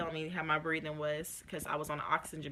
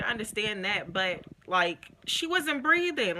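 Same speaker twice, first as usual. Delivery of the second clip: rising higher and louder into tears; tearful outburst; 4 semitones higher, 7 dB louder than usual. Retold or imitated. Mother reliving the baby not breathing, climbing into tearful loud distress. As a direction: break as it climbs to she wasn't breathing. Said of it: "busted out into tears again".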